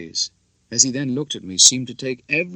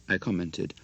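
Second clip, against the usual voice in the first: plain reading voice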